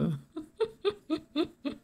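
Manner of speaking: short high-pitched giggle; high laugh. Real, not acted. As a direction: laugh lightly at the lamp. Laughs at red lamp. (petit rire aigu)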